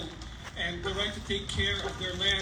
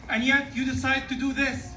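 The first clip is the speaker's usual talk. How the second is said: impassioned rally speech; 7 semitones higher, 7 dB louder than usual. Real, not acted. Speaker at rally pleading with celebrants, higher and impassioned. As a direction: rise to a passionate plea.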